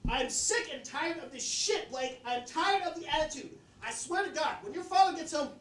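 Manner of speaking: high, held-back mock scolding; mom mid-yell. Mom yelling at her son, imitated high scolding. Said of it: "Here's my impression of it".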